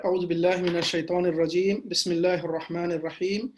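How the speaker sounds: normal talking voice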